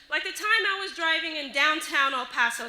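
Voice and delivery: ordinary talk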